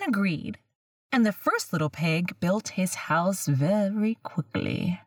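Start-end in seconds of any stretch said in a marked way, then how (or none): none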